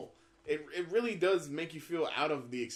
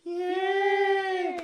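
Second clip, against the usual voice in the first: very high mock crowd "yay"; crowd cheer; 7 dB louder than usual. Imitating the E3 crowd cheering at the reveal. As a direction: squeal like a cheering fan.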